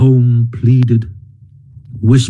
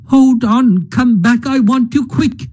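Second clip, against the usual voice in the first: urgent calling; 9 semitones higher than usual. Acted. Mole calls Ratty back urgently.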